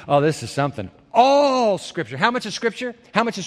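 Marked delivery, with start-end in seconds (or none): 1.1-2.2 slow emphatic. Acted. Preacher savoring the key words.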